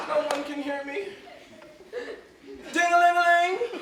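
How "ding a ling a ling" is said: mock bell ringing. Ringing bell, calling servant.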